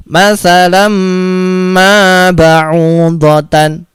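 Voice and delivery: slow recited "masalam ma ba'udotan" with a humming mim; drawn-out "ma ba'udotan" with a buzzing m; nasal mim merge. Shown as a demonstration. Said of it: "Bagaimana cara bacanya?".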